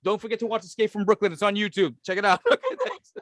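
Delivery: quick, high hammed-up promo; fast high mock usher voice; mock announcer. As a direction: rattle off a plug like a mall announcer. Mock promo while evacuating.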